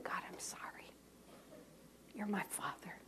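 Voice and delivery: soft unvoiced whisper; a sister whispering her sorrow to God; whispered repentance.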